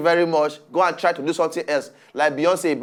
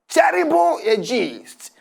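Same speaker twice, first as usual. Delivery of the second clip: mock wail; 6 semitones higher, slower than usual. Lamenting a sad story.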